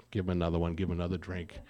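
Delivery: ordinary talk, nothing marked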